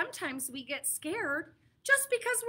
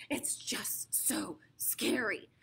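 unvoiced slow whisper; hushed spooky whisper; scared whisper; 8 semitones lower, 6 dB quieter, slower than usual. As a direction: whisper it like a secret fear. Teller admits fear.

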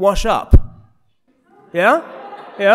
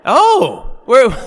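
surprised; 5 semitones higher, 6 dB louder than usual. Real, not acted. Surprised at the vote.

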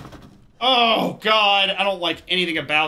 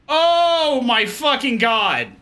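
scared outburst; 5 semitones higher, 5 dB louder, much slower than usual. Real, not acted. Jump scare in horror game.

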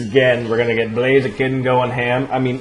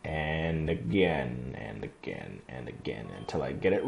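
much lower, softer, slowing each time; fading resignation; 10 semitones lower, 13 dB quieter than usual. Real, not acted. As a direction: let each again sink and fade. Resigned repetition.

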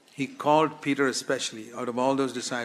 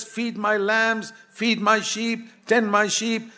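commanding Jesus; 10 semitones higher, 5 dB louder, slower than usual. Acted. Jesus commands, preacher raised.

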